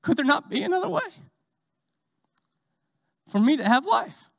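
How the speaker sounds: high, pleading question; pleading believer. Retold or imitated. Believer pleading with the Lord for another way. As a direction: beg for another way, voice lifted. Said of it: "And you say".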